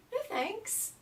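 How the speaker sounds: high, small "no thanks"; high polite refusal. Retold or imitated. Traveler declining the old man's joint, high and polite.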